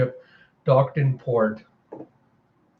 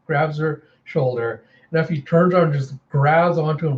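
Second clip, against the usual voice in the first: ordinary delivery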